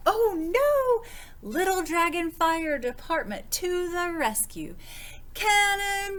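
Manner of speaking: high, loud, gleeful cries; excited shouts. Acted. Little Dragon playing fire department in the bath, high gleeful shouts. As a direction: shout it like a kid jumping in. Bath-time rescue game.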